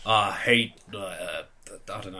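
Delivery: put-on slow, lazy Southern accent; a hint of a Southern drawl. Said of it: "you sound like a really sexy Southerner".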